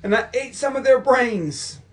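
loud, high, drawn-out hammed-up wail; over-the-top desperate caller; frantic mock confession. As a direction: confess it slowly at high pitch. Acted confession continues after interjection.

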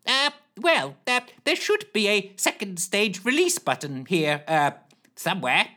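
high hesitant flustered voice; flustered Lushthorpe. Acted. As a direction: dither high and unsure.

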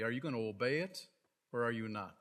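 ordinary delivery

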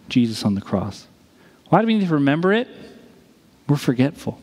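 ordinary talk

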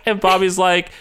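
ordinary delivery

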